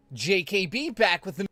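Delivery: mock host intro; own intro. Shown as a demonstration. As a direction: hype up the channel opening. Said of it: "The secret is out".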